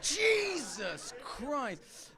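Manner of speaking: slow, dragged-out mock horror; mock shock. Comic playing a horrified listener, slow and stunned. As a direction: let it out slowly, appalled. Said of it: "you're like".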